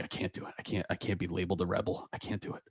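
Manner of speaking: whispered, barely there; whispered panic. Retold or imitated. Student too scared to confront a teacher, whispering. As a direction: lose your nerve in a whisper. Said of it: "You're like".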